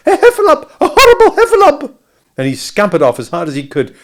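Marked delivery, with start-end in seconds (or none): 0.1-2.0 very high, loud panicked squeal; Piglet crying out. A narrator's reading. Piglet fleeing the heffalump, high frightened cry. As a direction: squeal the alarm at full pitch. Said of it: "cried Piglet".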